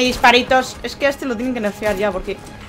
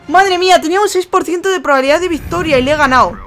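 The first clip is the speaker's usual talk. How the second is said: excited; 6 semitones higher, 8 dB louder than usual. Real, not acted. Just won unlikely match.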